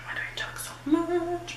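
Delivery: a whispered, self-teasing question; whispered aside. Real, not acted. The vlogger teases herself about rambling, in a whisper. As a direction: whisper it to yourself, half-amused.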